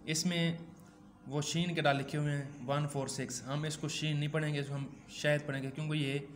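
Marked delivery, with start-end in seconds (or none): none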